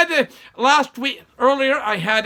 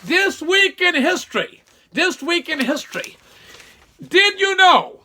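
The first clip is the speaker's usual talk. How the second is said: segment intro; 6 semitones higher, 4 dB louder than usual. Acted. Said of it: "I'm gonna give you a did you know".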